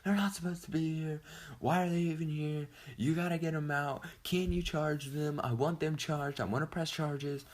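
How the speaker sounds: imitating the girl yelling at the cops, high-pitched; mock screaming girl. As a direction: squeal it like a bratty girl, high and whiny. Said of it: "screaming at the cops like".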